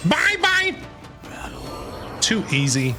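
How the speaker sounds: usual speaking voice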